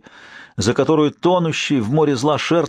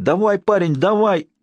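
urging on; 8 semitones higher than usual. Acted. Urging Sher to push harder.

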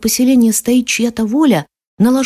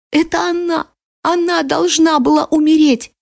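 put-on high, insistent child's voice; obsessively repeating boy; 7 semitones higher than usual. A narrator's reading. Sobbing boy clings to a soldier, repeating obsessively. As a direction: keep blaming her, high and fixated. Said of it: "как заведённый повторял он".

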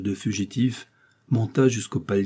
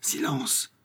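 whispered, softer warning to keep quiet; hushed command; 6 dB quieter than usual. Acted. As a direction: whisper it as an order to hush. Hushing her while hiding, eavesdropping. (ordre chuchoté)

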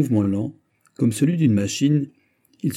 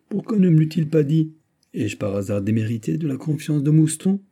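high-pitched, put-out questioning; mildly indignant, voice raised in pitch; affronted questioning; 6 semitones higher than usual. A narrator's reading. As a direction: challenge him as if slighted. Friend feels slighted, questions back.

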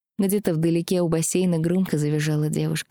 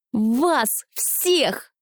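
angry; 7 semitones higher than usual. Acted. Heroine yells at Rastus.

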